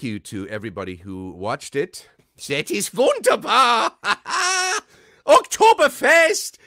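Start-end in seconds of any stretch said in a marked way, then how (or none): 2.5-3.9 put-on high, shouted German-accented rejoicing; jubilant German character. 4.0-4.8 high, loud theatrical laugh; jubilant character laugh. Acted. German character's exultant laugh. 5.3-6.6 put-on very loud, high, slow German-accented shout; jubilant German cheer. German character cheers for the festival.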